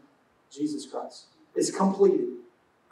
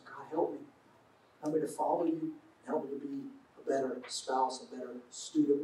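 soft, lowered, humble plea; quiet prayer; 5 semitones lower, 12 dB quieter than usual. Shown as a demonstration. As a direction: pray it softly, as if privately. Models a short daily prayer.

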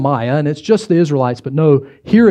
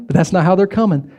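usual speaking voice

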